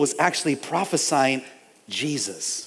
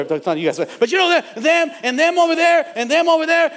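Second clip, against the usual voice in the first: ordinary delivery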